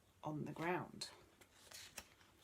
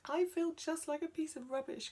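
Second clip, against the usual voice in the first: high, loud groaning complaint; high-pitched whiny groan; 10 semitones higher, 5 dB louder than usual. Acted. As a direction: groan it out, miserable. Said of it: "groaned Tim".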